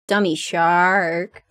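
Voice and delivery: slow, stretched-out "Dummy shark"; drawn-out taunt. Acted. Taunting the shark as a dummy, drawn out.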